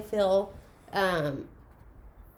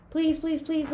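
mock begging; 8 semitones higher, slower than usual. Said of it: "begging my mom, like".

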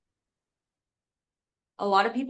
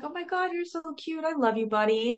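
loving dog talk; 9 semitones higher, faster than usual. Retold or imitated. Owner sitting with her cute dog, cooing with love. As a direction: coo adoringly to a pet, starting high. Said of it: "like, this love towards him".

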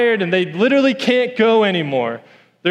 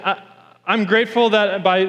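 ordinary talk, nothing marked

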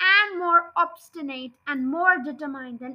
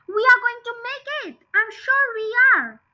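high, eager reassurance; hopeful Mister Fox; 9 semitones higher, 5 dB louder than usual. Acted. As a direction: cheer the family on, higher and brighter. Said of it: "Mister Fox would say".

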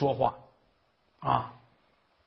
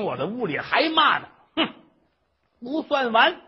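put-on unrelenting old scold; 7 semitones higher, 4 dB louder than usual. Mother keeps scolding once indoors.

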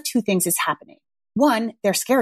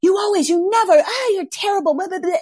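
mock parent outburst; 10 semitones higher than usual. Mom losing it at her kids, mock high rant. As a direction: blurt out hurtful lines, high-pitched. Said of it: "The things like".

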